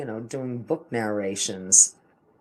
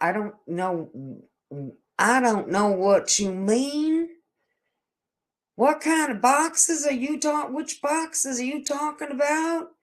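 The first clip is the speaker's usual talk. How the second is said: imitating the lady; 12 semitones higher than usual. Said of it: "kinda like".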